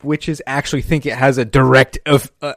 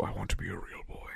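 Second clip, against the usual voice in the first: soft whispery puppet voice, lower; hushed, eerie imitation; Pinocchio; 6 semitones lower, 18 dB quieter than usual. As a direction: whisper it as a creepy cartoon puppet.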